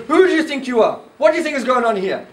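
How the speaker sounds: high-pitched acted challenge; indignant villain's retort; confrontational. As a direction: turn on an intruder, demanding. Kal Yug turns on the rider.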